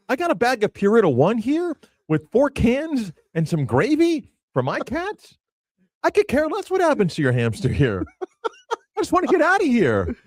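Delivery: high-pitched comic rant; exasperated protest. Retold or imitated. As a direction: plead to be left out of it, pitch climbing. Comic rant as innocent bystander.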